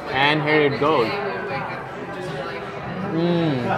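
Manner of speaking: ordinary talk, nothing marked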